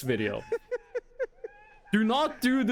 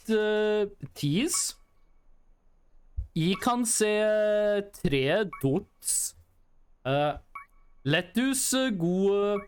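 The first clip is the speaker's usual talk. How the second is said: put-on slow, halting English in a heavy Norwegian accent; thick Norwegian accent; much slower than usual. Streamer shows chat a broken-English Norwegian accent. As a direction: hesitate with eh between words, Norwegian vowels throughout. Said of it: "kinda like that, but minus the Norwegian accent".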